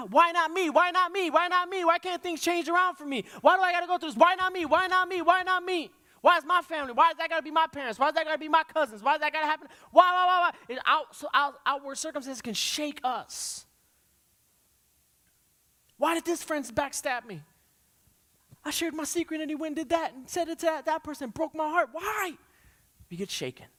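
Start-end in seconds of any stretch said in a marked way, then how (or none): 0.1-10.7 high, loud repeated cry of why not me; preaching the sufferer's loud lament; anguished complaint. Acted. Voicing despairing man by pool. 16.0-22.4 hurt complaint. Acted. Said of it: "voicing betrayed friend's complaint".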